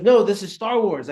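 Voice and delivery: sharp loud correction; loud retort. Retold or imitated. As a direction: snap it back loudly. Acted girlfriend retort, loud.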